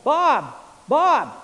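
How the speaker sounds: loud, high call with falling end; imitated distant shout for a son; father yelling. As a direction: call the name out across the water. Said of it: "my father yelling".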